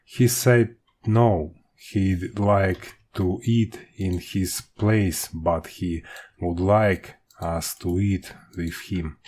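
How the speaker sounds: usual speaking voice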